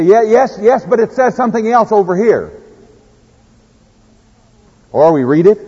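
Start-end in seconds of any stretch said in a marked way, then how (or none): 0.0-2.5 higher, lightly mocking an evasive believer; imitating a self-excusing reader; mock excuse. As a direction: play the dodging churchgoer. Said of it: "and we say".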